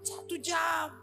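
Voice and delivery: high, slow cry of "satu jam" then loud confession; emotional shout. Retold or imitated. The brother tells the congregation he didn't understand a word, high and loud. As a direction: plead it out, half laughing at himself. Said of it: "Kan emosional gitu".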